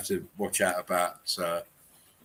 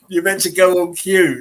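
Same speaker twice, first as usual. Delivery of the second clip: loud, high mock director scolding; 11 semitones higher, 10 dB louder than usual. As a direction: bark it like a stage director. Plays a director scolding an actor.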